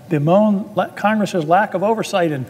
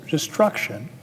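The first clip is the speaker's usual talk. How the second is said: ordinary talk, nothing marked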